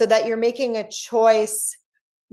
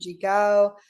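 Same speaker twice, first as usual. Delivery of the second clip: ordinary talk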